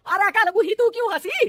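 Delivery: sharp high scolding snap; angry scolding. Acted. Mother-in-law scolds laughing daughter-in-law, high sharp scold.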